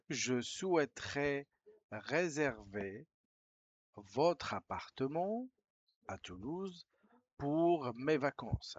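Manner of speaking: slow, deliberate reading of the French line; slow dictation. Shown as a demonstration. Teacher reading the email sentence to Spanish learners, slowly. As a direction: read it out phrase by phrase, slowly.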